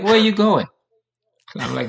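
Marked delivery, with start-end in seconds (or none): 0.0-0.7 high, louder startled question; mock a mother's alarmed demand, pitched way up. Mom questioning son about his sudden departure, startled and high.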